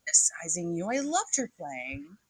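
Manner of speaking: ordinary delivery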